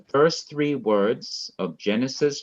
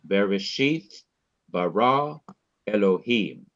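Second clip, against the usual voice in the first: Bereshith, bara, Elohim, each stressed on its final syllable; stress on last syllable. Shown as a demonstration. Teacher demonstrating the first three words of Genesis, final-syllable stress. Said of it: "the last syllable of each word is to be stressed".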